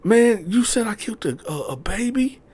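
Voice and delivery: very high, loud, frantic; man panicking about the baby, very high; panicked boyfriend. Retold or imitated. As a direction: stammer in panic. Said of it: "He like".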